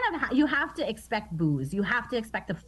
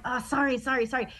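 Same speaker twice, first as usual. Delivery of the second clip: retold quick apology; 5 semitones higher, faster than usual. Retold apology to her own family.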